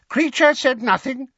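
high, innocent elf denial; house elf voice. A narrator's reading. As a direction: feign innocence like a servile elf. Elf denies it.

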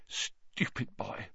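choked-up, whispered and slow; thick voice. A narrator's reading. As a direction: say it thick after blowing your nose. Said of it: "blew her nose and said thickly".

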